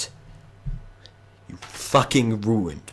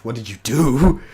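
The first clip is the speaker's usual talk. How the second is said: angry demand; 3 semitones higher, 7 dB louder than usual. Acted. Villain confronts players angrily.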